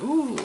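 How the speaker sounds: high, loud mock crowd "ooh"; impressed audience ooh. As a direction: imitate an awed theatre audience. Imitating an audience fooled by play.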